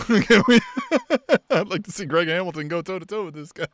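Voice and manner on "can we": laughing through the words. Real, not acted. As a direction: giggle out the question. Laughing while joking.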